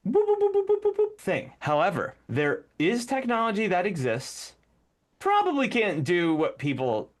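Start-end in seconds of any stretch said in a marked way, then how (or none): 0.1-1.5 very high, clipped beeping mouth noises; mocking mind control as sci-fi beeps; robotic mind control beeps. Shown as a demonstration. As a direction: boop like a sci-fi mind ray. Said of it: "not that".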